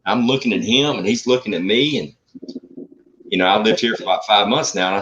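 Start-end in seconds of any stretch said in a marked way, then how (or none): none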